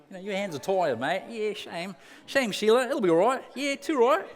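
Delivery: a jokey Aussie bloke consoling his mate; mock Aussie accent. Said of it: "He's got an Aussie accent all of a sudden".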